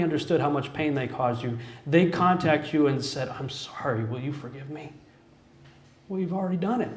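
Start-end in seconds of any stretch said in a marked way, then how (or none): 3.3-4.9 soft, hushed plea for forgiveness; a quiet, broken sorry; tearful apology. Retold or imitated. Someone who hurt you asks forgiveness, softly and tearfully.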